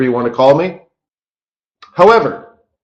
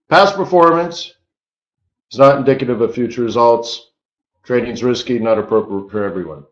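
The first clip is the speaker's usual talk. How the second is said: unhurried, spaced-out risk warning; measured disclaimer. Shown as a demonstration. Host repeating the risk warning after rushing it, measured. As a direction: say each phrase separately, with pauses. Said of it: "Did I say that too fast?".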